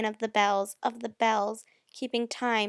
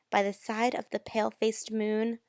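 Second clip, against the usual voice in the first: ordinary talk, nothing marked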